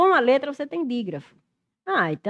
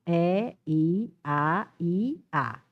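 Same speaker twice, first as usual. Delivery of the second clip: "E, I, A, I, A" as separate held vowels with gaps; vowels sounded one by one; 4 semitones lower, much slower than usual. Shown as a demonstration. Teacher counting vowel sounds, "E, I, A, I, A" held one at a time. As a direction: draw out each vowel of "E, I, A, I, A" on its own. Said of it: "som vocálico".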